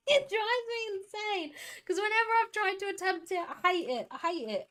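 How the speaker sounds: high-pitched, half-laughing frustrated whine; exasperated whine. Real, not acted. Streamer venting about a lighting trick, whining.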